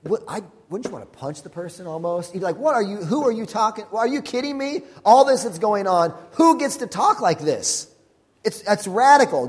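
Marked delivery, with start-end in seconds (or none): 2.6-7.9 high-pitched incredulous retort; indignant disbelief. Acted. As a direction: push back in disbelief, voice climbing. Imagined angry retort to glib comforter.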